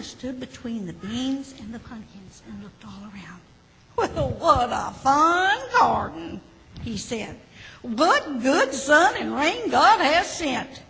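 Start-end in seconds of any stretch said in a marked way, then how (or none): 4.0-6.4 lightly voiced farmer, slow and pleased; slow pleased farmer, a touch put on. A narrator's reading. Farmer admires his garden, slow lightly voiced pleasure. 7.8-10.8 lightly voiced farmer, slow and pleased; slow pleased farmer, a touch put on. A narrator's reading. Farmer admires his garden, slow lightly voiced pleasure.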